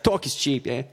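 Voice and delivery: lightly put-on Brooklyn accent; playful mock Brooklyn voice. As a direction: say it like a Brooklyn local. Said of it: "even better in a Brooklyn accent".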